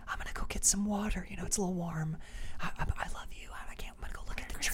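hushed whisper, barely any voice. Retold or imitated. Man whispering to his sleeping partner at night, hushed whisper. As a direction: whisper so you won't wake her.

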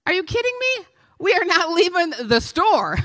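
high, disbelieving outburst; mock outrage at the idea; incredulous. Real, not acted. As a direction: toss it off in disbelief. Preacher's incredulous retort.

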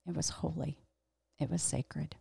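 very soft, reverent murmur; hushed reverence. Real, not acted. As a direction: drop to a near hush, as if on holy ground. Reverent close after burning the table.

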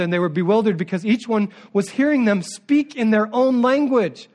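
ordinary talk

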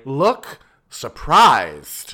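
loud, very slow deadpan command; drawn-out stage direction; mock director voice. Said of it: "because she's just terrible".

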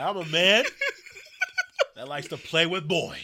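loud, high, drawn-out wrestler imitation; mock promo shout. Friend echoing the funny promo, booming mock wrestler. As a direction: bellow it slowly, amused.

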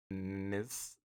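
ordinary talk, nothing marked

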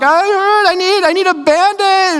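high-pitched mock wail of a hurt little girl; crying Addie. Dad retelling his young daughter's boo-boo, mock crying, high-pitched. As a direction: imitate your young daughter whining through pretend tears. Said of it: "And so Addie was crying".

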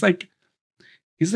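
normal talking voice

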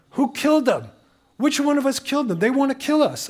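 high, urgent retold alarm of a child. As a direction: act out a child's alarm, pitched well up. Eight-year-old reacting to threatening graffiti.

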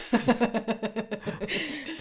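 high, drawn-out giggle; burst of laughter. Real, not acted. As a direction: laugh it off helplessly. Laughing at the cockatoo story.

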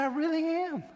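put-on high, pleading little-kid voice; scared child's plea. Child insisting to mom in dark.

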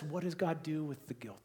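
whispered question, drawing them in; soft unvoiced ask. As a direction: hush it to a secret. Sudden drop after loud lines.

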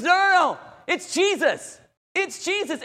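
high, loud, eager cry of recognition; excited shout. Acted. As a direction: call out joyfully that it's Him. Peter recognizing Jesus, excited.

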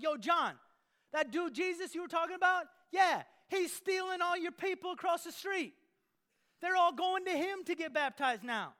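high, loud, worked-up complaining; John's disciples running to him, high and outraged; indignant disciples. Acted. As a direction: tattle on Jesus like an outraged follower.

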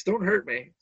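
ordinary talk

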